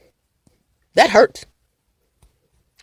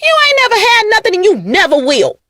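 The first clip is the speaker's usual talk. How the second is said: high fast delivery; 10 semitones higher than usual. Shown as a demonstration. Acting the insult again, high and fast. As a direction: rush it out much higher. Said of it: "Let me say it like that".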